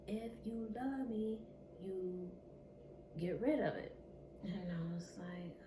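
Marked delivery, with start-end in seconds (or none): 0.1-4.0 harder, louder push on get rid of it; aggressive demand. Retold or imitated. Narrator imitating her abuser demanding an abortion, mock aggressive. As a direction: voice his aggressive ultimatum, a bit harsher.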